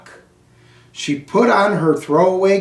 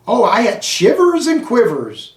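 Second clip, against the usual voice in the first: mock shivers; 7 semitones higher than usual. A narrator's reading. Hamster scared by story.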